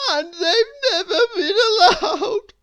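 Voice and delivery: high, loud sobbing. A narrator's reading. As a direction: sob the words through tears. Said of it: "he sobbed".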